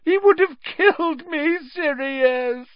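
very high, tearful wail; squeaky, pleading cry; whining. A narrator's reading. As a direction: wail it desperately. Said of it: "whined Pettigrew".

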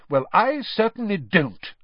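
put-on self-righteous older brother; sanctimonious. A narrator's reading. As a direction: look down your nose at it. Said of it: "said Percy sanctimoniously".

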